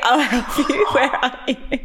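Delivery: high, laughing imitation of a soothing friend; mock soothing friend. Teasing Riley by copying her comforting, mock soothing. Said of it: "You're like".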